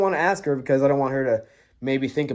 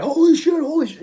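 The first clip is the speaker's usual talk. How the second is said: high panicked "holy shit"; freaked-out yelp; 13 semitones higher, 5 dB louder than usual. Retold or imitated. Friend on the phone hearing footsteps, panicking high. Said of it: "we're both freaking out".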